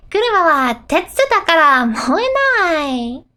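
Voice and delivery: put-on high, carefree girl's voice; Inotsuba. Unconcerned friend sure an iron car won't burn, carefree. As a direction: say it innocently, lilting high. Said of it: "Inotsuba is unconcerned".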